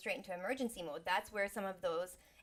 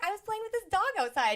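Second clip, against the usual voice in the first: put-on higher and louder, eager little-girl tone; excited child; 12 semitones higher, 9 dB louder than usual. Muddy daughter explains to mom, eager and excited. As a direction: tell mom about the dog, beaming. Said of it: "And I was like".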